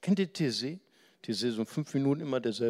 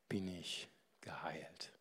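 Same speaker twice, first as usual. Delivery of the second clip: whisper; 6 semitones lower, 13 dB quieter, slower than usual. Acted. Sick woman's hope, quoted.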